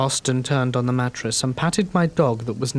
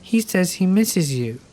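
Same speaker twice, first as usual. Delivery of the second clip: put-on higher-pitched small child; toddler's voice; 6 semitones higher than usual. A narrator's reading. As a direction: pass on a message as a little girl would. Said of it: "and say".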